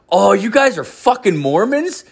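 high-pitched incredulous question; surprised realisation. Retold or imitated. Drunk host realising his doorstep visitors are Mormons, surprised. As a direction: voice leaps up as it dawns on you.

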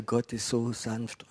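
slow, soft and gentle. Retold or imitated. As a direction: say it softly and tenderly, drawn out. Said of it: "ich spreche über diese Sanftheit Gottes".

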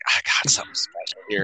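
deep, hushed, unvoiced boast. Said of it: "[name] was just like".

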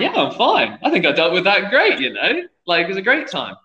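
brushing off the chairman's concern, ironically upbeat; mock cheerful. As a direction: pretend everything went great. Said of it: "And I was like".

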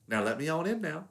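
put-on a playful Southern twang, coaxing the girl to open the door; mock Southern accent. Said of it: "she adopts a Southern accent".

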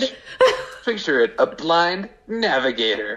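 high-pitched, hammed-up comedy trailer voice; movie trailer parody. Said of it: "like, movie trailer".

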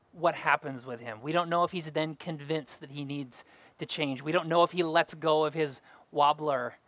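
usual speaking voice

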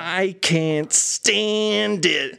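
high, very drawn-out whine; grainy, whiny voice. Retold or imitated. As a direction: whine the mantra, pulling each word long. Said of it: "like, a grainy, whiny voice".

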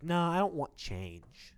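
slow, high imitation of a stubborn customer; stubborn refusal. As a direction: dig in like an obstinate customer.

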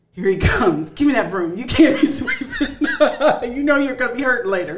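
loud, laughing mock scolding; bossy interruption, hammed up through giggles; imitating fussing husband. Wife mimics husband taking the broom away, mock scolding.

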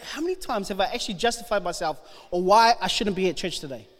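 normal talking voice

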